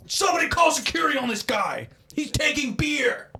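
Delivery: high, loud mock shout for security; imitating shouting. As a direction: yell for security like a petty vigilante. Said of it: "He's like".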